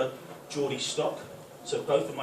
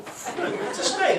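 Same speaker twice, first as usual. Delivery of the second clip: high, drawn-out exasperated cry; hammed-up, slow, loud protest; mock exasperation; 6 dB louder, much slower than usual. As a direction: wail it in comic disbelief. Mock exasperation at the Yorkshire phrase.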